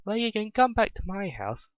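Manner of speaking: put-on cheerful invitation from a small girl; happy little girl. A narrator's reading. Rabbit girl inviting the hungry pony home, happy child voice. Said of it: "the rabbit girl said happily".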